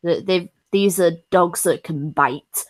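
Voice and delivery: normal talking voice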